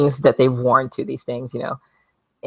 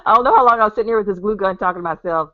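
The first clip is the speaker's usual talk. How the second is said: fast, higher, laughing words; real amused laughter; 6 semitones higher, faster than usual. Real, not acted. Vlogger amused she talked to herself with a glue gun, laughing. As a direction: rush it out through a laugh.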